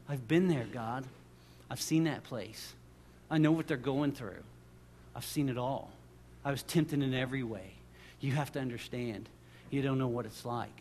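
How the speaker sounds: low, soft pleading with pauses; quiet intercession. Acted. Jesus pleading to God for people he understands, low and quiet. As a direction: intercede gently, phrase by phrase.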